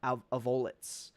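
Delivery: "Avolites" as slow uh-VOH-luts; middle-stressed variant. Shown as a demonstration. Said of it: "four different ways that you can".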